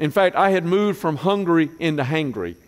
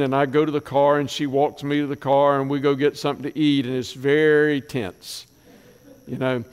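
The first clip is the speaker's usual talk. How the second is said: ordinary delivery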